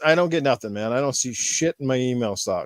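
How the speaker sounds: ordinary talk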